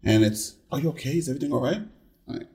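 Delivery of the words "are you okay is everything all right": soft, hushed check-in; concerned coworker. Retold or imitated. Coworker asking about a resting face, softly concerned. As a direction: softly imitate a worried colleague. Imitates worried colleagues at work.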